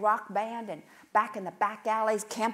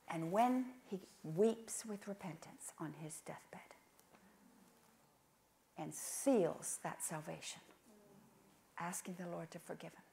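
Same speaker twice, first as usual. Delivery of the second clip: hushed; 5 semitones lower, 10 dB quieter than usual. Real, not acted. Solemn hushed deathbed account.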